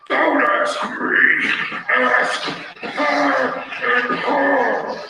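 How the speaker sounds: slow, loud, fed-up; fed-up wife. Retold or imitated. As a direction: drag it out, exasperated. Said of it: "My wife's going".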